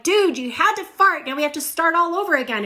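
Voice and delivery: high, loud exasperated outburst; exasperated shout. Retold or imitated. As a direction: blurt it out fed up. Exasperated family retold.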